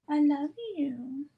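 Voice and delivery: slow, high loving coo; tender to the dog. Real, not acted. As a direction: say it slowly like cuddling a pet. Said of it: "talk like that to me".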